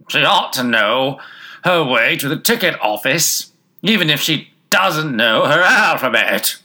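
loud, high, slow bellow; loud goat voice. A narrator's reading. Goat butting in on the passengers' talk, loud voice. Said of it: "said in a loud voice".

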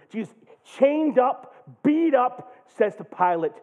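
high, loud fervent preaching; full-force preaching. Real, not acted. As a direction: preach it with all you've got.

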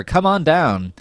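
ordinary talk, nothing marked

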